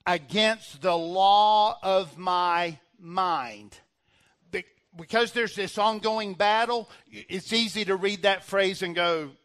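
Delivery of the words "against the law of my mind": loud, very drawn-out preacher's reading; emphatic scripture reading. Real, not acted. Preacher reading the verse to the church, slow and forceful. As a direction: stretch every word of the verse forcefully.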